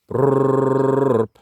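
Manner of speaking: rolling brrr call to the animals; lip trill call. Real, not acted. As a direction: trill the lips to call the mongoose. Calling the mongoose with trill.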